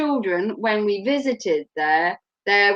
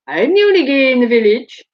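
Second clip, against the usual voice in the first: lightly voiced camp Daffyd from Little Britain, louder; Daffyd impression; 3 semitones higher, 5 dB louder than usual. Quoting Little Britain to a friend, mildly camp Daffyd. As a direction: proclaim it like Daffyd.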